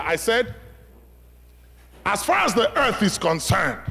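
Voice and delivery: loud, high questioning sermon voice; forceful preaching. Acted. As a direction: press the question on the congregation. Preacher presses question on crowd.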